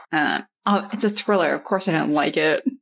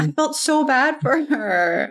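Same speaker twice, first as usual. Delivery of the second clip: touched sympathy; 8 semitones higher than usual. Real, not acted. Sympathy for Lori's blurry childhood.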